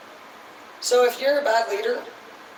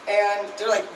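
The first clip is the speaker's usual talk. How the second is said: usual speaking voice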